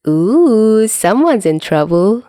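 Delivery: mock him in a singsong; teasing singsong. Dazai teases the scolded Chuya, singsong. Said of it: "Dazai sang from his seat".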